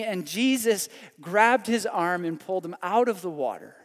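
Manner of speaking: ordinary delivery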